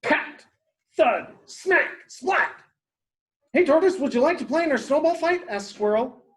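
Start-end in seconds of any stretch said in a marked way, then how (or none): 0.0-2.5 short, high sound-effect words; snowball impacts. Acted. Snowballs land near napping Tortoise, punchy impact noises. 3.5-5.4 high, very fast invitation; eager squirrel. Acted. Squirrel invites Tortoise to a snowball fight, excited rush.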